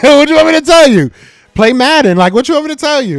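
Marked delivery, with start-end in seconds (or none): 0.0-2.2 much higher and louder, laughing disbelief; incredulous taunt. Real, not acted. Streamer laughing at Cowboys fans, high and loud. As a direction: throw it at them with a laugh.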